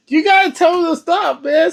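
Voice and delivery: high, urgent pleading; urgent plea. Real, not acted. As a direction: beg him to speak up. Reactor urging in distress.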